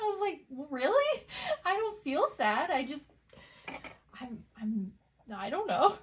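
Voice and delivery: high, loud, laughing disbelief; startled denial. Retold or imitated. Retelling her own startled reply.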